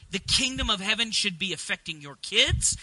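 ordinary talk, nothing marked

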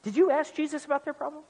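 lightly acted, baffled God; mock bewilderment, half amused; mock-helpless God. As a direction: play at being stumped for an answer. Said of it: "why do you say it like that".

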